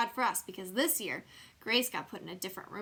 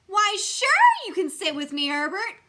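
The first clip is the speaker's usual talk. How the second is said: much louder and higher welcoming call; real loud; 10 semitones higher, 12 dB louder than usual. A narrator's reading. Junie B letting Herb sit with her, loud glad offer. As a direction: belt it out gladly. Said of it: "I raised my voice real loud".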